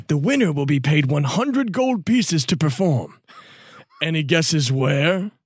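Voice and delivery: theatrical reveal with high swoops; Dwoot, officious informant. Acted. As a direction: build to the answer like a showy announcer.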